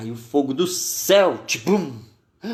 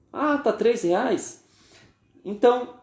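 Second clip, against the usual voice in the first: mock surprise at price; 8 semitones higher than usual. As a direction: play the haggler pretending the price shocks him. Rich man reacts to price. (surpresa fingida, aguda e lenta)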